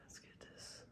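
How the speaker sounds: concentrated whisper; real whisper while working. Real, not acted. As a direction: whisper as you focus on the work. Working quietly on the painting.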